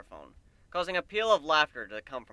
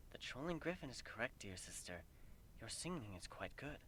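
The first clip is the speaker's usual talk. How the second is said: much softer, lower gentle reply; soft regal voice; 3 semitones lower, 18 dB quieter than usual. A narrator's reading. Celestia gently telling Luna her singing was good. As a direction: speak softly like a calm elder sister.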